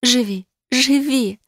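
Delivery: high, slow pleading cry; a desperate slow plea; begging. A narrator's reading. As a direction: beg him to live. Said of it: "взмолилась я".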